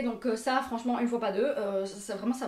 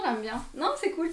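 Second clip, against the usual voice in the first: normal talking voice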